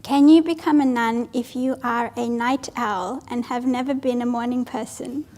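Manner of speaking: ordinary delivery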